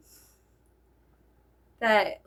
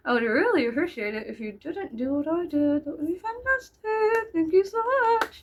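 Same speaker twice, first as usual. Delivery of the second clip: climbing into a high sing-song thank-you; over-the-top grateful, pitched way up; mock-polite plea; 6 semitones higher than usual. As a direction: plead sweetly with exaggerated courtesy.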